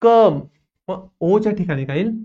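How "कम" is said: कम said "kum", higher and louder; emphatic model of कम as "kum"; "uh" vowel demo. Shown as a demonstration. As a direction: ring out कम with "uh" in place of o. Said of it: "अ चा pronunciation येतं".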